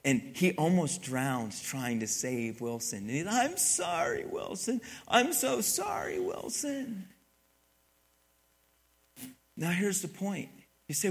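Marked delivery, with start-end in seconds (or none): 3.3-7.1 high-pitched, plaintive acted cry of sorry; anguished apology. Castaway failing to save his volleyball, high anguished apology.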